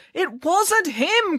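very high, drawn-out protest; desperate cry. A narrator's reading. Counsellor defending the throttled boy, desperate cry. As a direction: cry it out slowly in high distress. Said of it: "cried Mister Lang".

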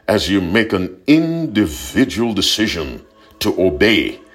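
ordinary delivery

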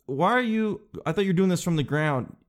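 normal talking voice

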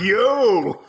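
high, loud mock "yo"; mock call-out. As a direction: call out like an excited crowd, playfully. Acting out crowd calling out.

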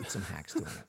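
hushed mock-serious aside; whisper. Whispered jab at the actors to friends.

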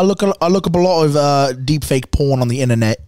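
amateur attempt at British; British accent, unsure of itself; put-on British accent. Shown as a demonstration. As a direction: do a shaky-quality imitation Brit. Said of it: "I wanna hear y'all's British accent".

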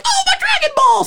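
very high squealed exclamation; pained cartoon cry. Retelling a Dragon Ball special to friends, high pained cartoon squeal.

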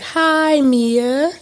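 high, cheery group reply in unison; crowd greeting. Retold or imitated. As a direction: play the whole support group answering back. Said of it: "I need the crowd to be like".

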